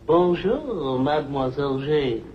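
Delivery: "Bonjour, Mademoiselle Jane" said in careful French; French greeting. Shown as a demonstration. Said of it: "you say".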